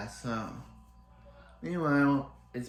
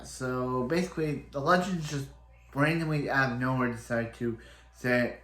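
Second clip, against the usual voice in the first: ordinary talk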